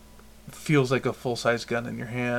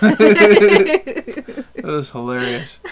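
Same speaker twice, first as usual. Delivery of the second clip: high, loud laugh into the words; real laughter; 8 semitones higher, 10 dB louder, much slower than usual. Real, not acted. Podcaster recalling being almost flipped off, laughing hard. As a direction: crack up while saying it. Laughing at own funny story.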